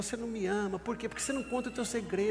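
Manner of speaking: high, fast whiny nagging; Delilah nagging. Acted. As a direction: press him, pouting. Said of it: "Ela falou".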